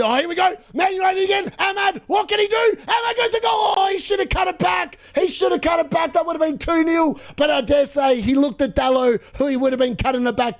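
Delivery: high-pitched shouted call of an attack; excited play-by-play. Real, not acted. Host calls a near miss to viewers, excited commentary. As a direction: shoot up in pitch as the chance builds, then settle.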